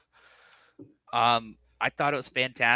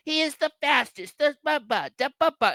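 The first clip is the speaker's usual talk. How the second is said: high, fast hammed-up announcer babble; mock commentator babble; 13 semitones higher, 3 dB louder than usual. Mocking Excalibur talking over the moment, high babble. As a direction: spoof a commentator rambling on. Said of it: "And Excalibur was like".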